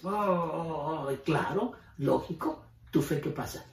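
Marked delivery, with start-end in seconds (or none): 0.0-1.1 slow drawn-out groaning oh; mock lament groan. Pastor mimics the envious believer with a slow groan. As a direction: groan it like someone sulking at others' luck. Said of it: "y dices".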